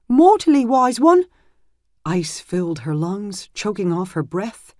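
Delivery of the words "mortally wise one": put-on high, loud young man; grim news from a boy; anxious young squire. Squire reports the wound.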